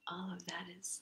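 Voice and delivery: soft pleased whisper. Real, not acted. Teacher whispers praise of the massage. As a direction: hush it, enjoying it.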